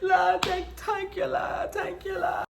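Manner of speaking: high, wailing thanks to God; hammed-up tearful praise; grateful praying mother. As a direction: imitate mom crying out in prayer. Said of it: "just being grateful that I'm okay".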